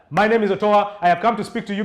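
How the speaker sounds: rushed start with no pauses; rushed no-pause opening. Shown as a demonstration. Trainer showing how not to open a speech, rushed. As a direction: blurt the intro straight out. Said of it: "Do not start".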